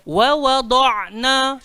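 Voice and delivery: "Wa wada'na" very slow, the d heavy with the whole tongue; model recitation. Shown as a demonstration. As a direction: recite for the class to copy. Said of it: "Cuba sebut".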